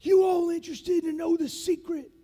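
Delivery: imitated instructor addressing a class, high and loud; instructor's voice. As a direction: call out to the candidates like a veteran drill instructor. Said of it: "he talks like this".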